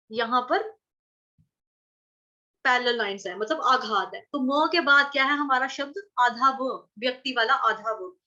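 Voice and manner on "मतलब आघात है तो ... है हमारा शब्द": ordinary talk, nothing marked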